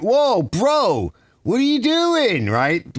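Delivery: high, alarmed yell at a careless worker; retold yell. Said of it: "I yelled at him".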